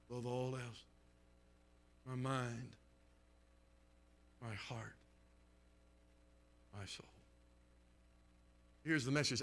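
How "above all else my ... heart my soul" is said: very soft, low, spaced phrases; almost murmur it, pausing after each; quiet, reverent pulpit close; solemn hush. Real, not acted. Preacher drops to solemn hush.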